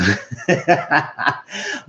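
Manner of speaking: amused burst of laughter. Real, not acted. Reviewer amused by the angry woman she mimicked, laughing. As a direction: crack up at your own impression. Laughs after her own impression.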